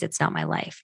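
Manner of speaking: whisper. Real, not acted. Soft aside after stating principle.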